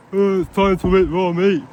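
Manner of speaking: mock the idea as naive; scoffing put-on voice. Mocking imagined naive decision.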